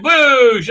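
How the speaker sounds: high, loud mouth-made blaster boom; gun blast sound. Retold or imitated. Woman firing at security guards: loud mouthed boosh. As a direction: spit out a blaster shot.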